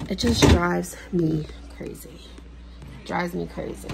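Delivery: whispered, exasperated aside. Real, not acted. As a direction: breathe it out, fed up. Exasperated aside under breath.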